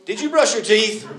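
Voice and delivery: suspicious parent. Retold or imitated. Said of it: "And you're like".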